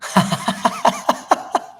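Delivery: breathy high laugh; airy laugh. Real, not acted. Laugh after scare.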